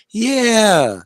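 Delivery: creepy satisfied yeah. Retold or imitated. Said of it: "It was so fucking creepy".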